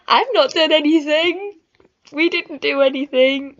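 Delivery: high, loud acted protest; indignant denial. Daughter denies everything to her dad, loudly protesting.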